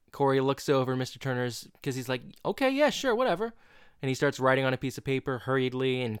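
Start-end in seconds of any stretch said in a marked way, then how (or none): 2.4-3.5 high-pitched, dismissive imitation of a teacher; offhand agreement. Teacher agreeing to scribble a note, offhand. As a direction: agree breezily just to get it done.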